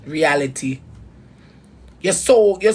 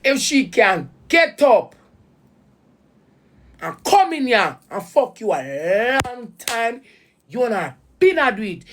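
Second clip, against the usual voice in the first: pained, rising to a higher voice; emotional, high-pitched; 4 semitones higher than usual. Real, not acted. Upset woman confronting her baby father, emotional. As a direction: voice pitched up with hurt, slowed. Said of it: "And I get so emotional".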